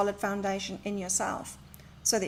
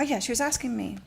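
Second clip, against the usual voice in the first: ordinary delivery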